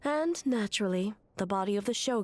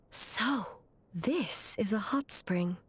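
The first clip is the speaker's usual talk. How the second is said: slow, breathy whisper of wonder; a slow whispered aside on first seeing the spring; hushed whisper; slower than usual. Acted. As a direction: breathe it out in awe. First arrival at hot spring.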